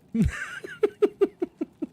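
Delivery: bursts into a high-pitched laugh; laughing at his own joke. Real, not acted. Streamer laughs at his own mirror joke.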